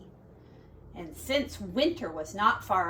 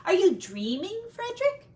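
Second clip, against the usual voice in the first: reproachful; 8 semitones higher than usual. Acted. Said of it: "they asked reproachably".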